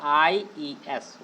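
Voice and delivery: IES spelled letter by letter, drawn out; spelled out slowly. Shown as a demonstration. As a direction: drag out each letter. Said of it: "क्या जोड़ते हैं". (IES को धीरे-धीरे अक्षर-अक्षर बोलें)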